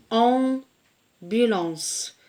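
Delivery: "ambulance" dragged out very slowly, each syllable held; drawn-out syllables. Shown as a demonstration. Teacher to pupils, sounding out words, very slow. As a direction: stretch every syllable of "ambulance". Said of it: "Par exemple".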